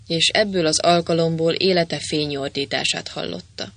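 plain reading voice

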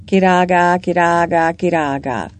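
lightly voiced repeating bird; a hint of a croaking bird; hoarse bird call. A narrator's reading. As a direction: chant it like a bird's cry, falling off at the end. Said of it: "egy reket hangú madár folyton azt mismételgette".

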